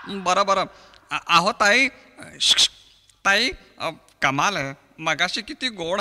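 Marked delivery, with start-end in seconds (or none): none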